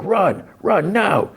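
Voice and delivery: high-pitched mock urgent call; drawn-out, high imitation of a warning. President Shah warns Young Shah to run, urgent mock call.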